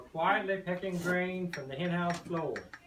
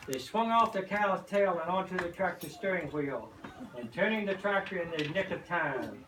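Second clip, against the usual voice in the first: plain reading voice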